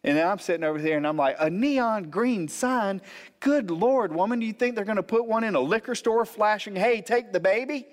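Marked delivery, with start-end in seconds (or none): 1.4-7.9 high-pitched mock disbelief; exasperated husband's comic protest; incredulous scoffing. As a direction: scoff at an absurd demand, pitched way up. Husband scoffs at wife's demand.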